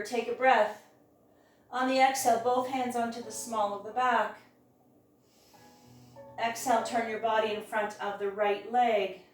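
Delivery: usual speaking voice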